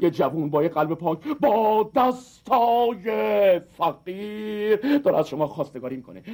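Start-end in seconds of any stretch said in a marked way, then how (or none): 1.3-5.0 milk each word like a melodrama; hammed-up plea. Suitor proposes to the driver, hammed-up dramatic cry.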